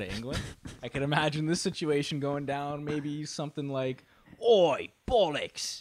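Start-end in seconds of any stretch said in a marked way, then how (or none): 4.4-5.8 slow, higher mock English outrage; English accent. English man finding the birthday present, outraged English accent. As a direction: bellyache in a broad English accent. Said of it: "is a, uh, English story".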